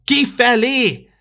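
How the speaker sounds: a very loud, high, drawn-out shout; loud roar. Acted. Old December roars at the fever a second time, very loud. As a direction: bellow it so the fever flees.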